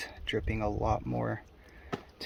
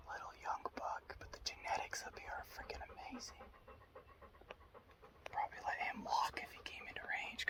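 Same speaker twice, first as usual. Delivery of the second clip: wildlife watching whisper; 12 semitones higher, 9 dB quieter than usual. Real, not acted. Whispering about a second buck.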